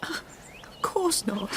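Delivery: slow breathless whisper. Acted. Wife whispers to her husband that the affair is over. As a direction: barely breathe the denial.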